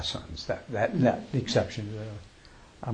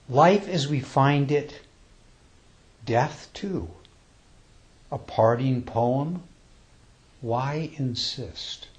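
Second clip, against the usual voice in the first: slow, measured recitation with long pauses; slow, reverent poem recital; poem reading; 2 semitones higher, much slower than usual. Acted. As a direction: read it like a sacred verse, unhurried. Reads a death poem aloud.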